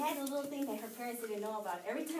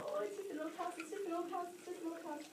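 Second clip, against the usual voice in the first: nervous self-reassurance; 6 semitones higher, 4 dB quieter than usual. Retold or imitated. Scared girl reassures herself.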